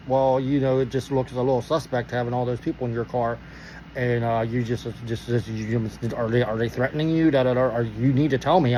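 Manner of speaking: low, halting, stumbling explanation; awkward hedging. Retold or imitated. Cop asks driver about passengers, low and awkward. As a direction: imitate a cop fumbling for a delicate way to ask.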